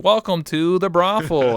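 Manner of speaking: slow, high robot tour guide; chirpy robotic welcome. Retold or imitated. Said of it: "the robotic voice".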